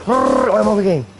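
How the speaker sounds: high-pitched manic impression; coked-up guy. Showing off acting on cocaine, high-pitched mock mania. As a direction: jump up high as a hammed-up cocaine user. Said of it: "how about I'm cocaine".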